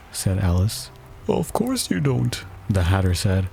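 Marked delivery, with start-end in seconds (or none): 1.3-2.4 high, slow, drawn-out disdain; contemptuous. A narrator's reading. Hatter putting Alice down, contemptuous. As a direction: dismiss her from on high, stretched out. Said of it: "tossing his head contemptuously".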